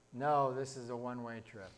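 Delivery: low, soft, understated reply; understated refusal. Acted. Angel answering the departing man, understated refusal. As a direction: drop low and quiet to refuse. Angel declines to come along.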